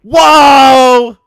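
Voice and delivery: loud high whoa shout. Real, not acted. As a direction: yell it out in alarm. Loud exclamation reaction.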